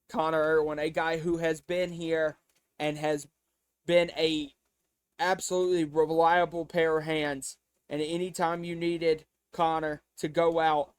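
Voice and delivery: ordinary delivery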